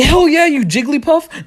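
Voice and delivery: high, slow, drawn-out sass; sassy high retort. Retold or imitated. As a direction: snap back in a high mocking voice. Insulting girlfriend after breakup.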